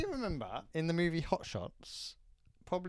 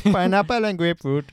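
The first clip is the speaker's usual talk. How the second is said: put-on much louder, higher Lilt advert accent; 5 semitones higher, 14 dB louder than usual. Co-host asks for the drink's advert accent, hammed-up accent. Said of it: "Say it in the accent".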